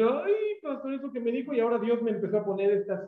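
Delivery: high, whiny complaint; mock complaining member. Congregant complaining to the pastor, high whine. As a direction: whine like a troubled churchgoer.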